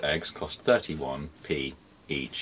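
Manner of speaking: low, soft, slow robot monotone. Acted. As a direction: drone it like a robot. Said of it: "Why do you sound like a robot?".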